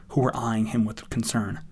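ordinary delivery

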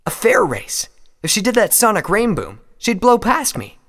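high, loud and serious; earnest young flier. Acted.

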